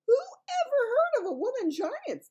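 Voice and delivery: high, loud giant shout. Acted. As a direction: bellow it at the girl in disbelief. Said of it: "shouted the B F G".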